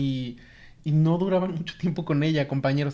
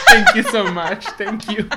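embarrassed laughing thanks; 6 semitones higher, 10 dB louder, slower than usual. Retold or imitated. Embarrassed thanks leaving bus.

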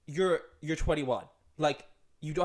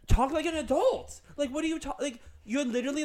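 exasperated rant; 10 semitones higher than usual. Real, not acted. Ranting at grown women acting babyish.

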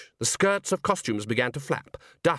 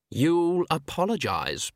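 slow, drawn-out taunt, pitched higher; jeering; 5 semitones higher, slower than usual. A narrator's reading. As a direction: jeer it, stretching the words. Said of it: "Perdita jeered".

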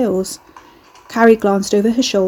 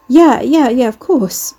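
high, hurried nervous agreement; raised, flustered string of yeses; flustered stutter; 4 semitones higher, 6 dB louder, slower than usual. A narrator's reading. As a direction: stumble over yourself agreeing. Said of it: "Pauline stuttered".

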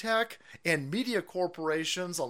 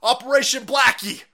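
higher, louder barking commander; mock South Park general; 7 semitones higher, 6 dB louder than usual. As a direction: announce it like a cartoon general. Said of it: "And they're like".

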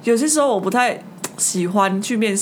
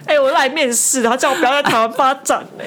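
higher, incredulous outburst; indignant disbelief; 4 semitones higher, 4 dB louder than usual. Real, not acted. Telling her friend about the absurd interview, higher incredulous protest. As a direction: protest how absurd it was. Indignant retort to boss's advice.

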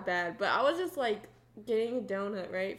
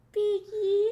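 high, drawn-out squeal of a name; squeaky, high-pitched calling; funny put-on voice; 9 semitones higher, 3 dB louder than usual. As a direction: imitate him in a silly high voice. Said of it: "do it in that voice".

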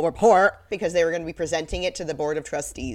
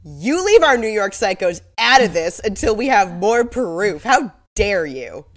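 mock outrage; 3 semitones higher, 8 dB louder than usual. Mock outrage defending New York.

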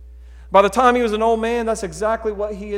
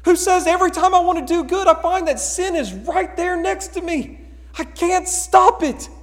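higher, pleading confession; imitating anguished Paul; 8 semitones higher, 3 dB louder than usual. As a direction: plead like a man who keeps failing. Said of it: "Paul in Romans seven who says".

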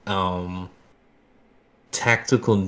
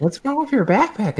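much higher, louder puzzled question; high confused classmate; 14 semitones higher, 5 dB louder than usual. Retold or imitated. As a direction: ask like a baffled classmate.

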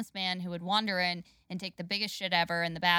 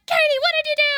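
shrieking mock scolding, very high; Rita; 12 dB louder, faster than usual. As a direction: scold a coworker as a frantic crackhead waitress. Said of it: "she was like".

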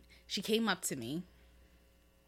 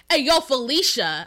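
confrontational callout; 3 semitones higher, 7 dB louder than usual. Retold or imitated. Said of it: "she's coming at me hot".

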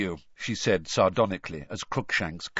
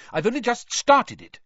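high, louder, brisk explanation; 13 semitones higher, 6 dB louder than usual. A narrator's reading. Hermione to Ron about her new society, briskly. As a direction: state it briskly and emphatically. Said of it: "said Hermione briskly".

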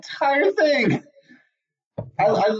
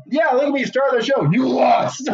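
gloating mayor; 8 semitones higher, 3 dB louder than usual. Retold or imitated.

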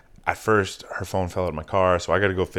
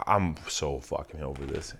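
low whispered mock complaint; imitating a drunk girl's whispered gripe; whispered drunk grumble; 6 semitones lower, 8 dB quieter than usual. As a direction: breathe it out, fed up and drunk. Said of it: "she's like".

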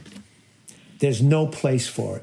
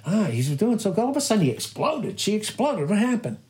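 lightly mock-astonished onlooker, pitched up; baffled onlooker; 7 semitones higher than usual. Preacher mimics people reacting to a sudden outburst, mock surprise. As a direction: act out a puzzled bystander's surprise.

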